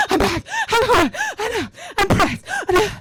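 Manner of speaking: high, loud breathless gasps; out of breath. Retold or imitated. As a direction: pant the words out. Panting on the bike.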